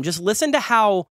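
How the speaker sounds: normal talking voice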